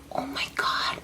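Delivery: breathless unvoiced whisper of shock; hushed, stunned whisper. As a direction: whisper it in disbelief. Shocked at mom's call.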